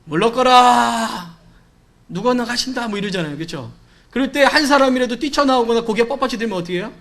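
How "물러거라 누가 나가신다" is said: drawn-out, very slow royal herald's call; period drama herald. Retold or imitated. Herald clearing the road for the king, drawn-out call. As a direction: stretch out 물러거라 like a palace guard clearing the road. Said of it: "사극 같은 데 보면 왕이 행차하면".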